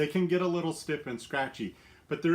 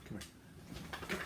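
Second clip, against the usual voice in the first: soft whispered call to a dog; whispered coaxing; 14 dB quieter than usual. Real, not acted. Owner quietly luring her dog closer, whispering.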